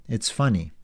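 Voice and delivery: usual speaking voice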